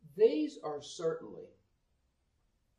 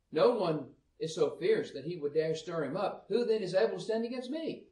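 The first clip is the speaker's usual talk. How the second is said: raised, higher Bible reading voice; scripture reading; 4 semitones higher, 3 dB louder than usual. Acted. Preacher reading Job to the congregation, raised and declaiming. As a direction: read the verses out with force.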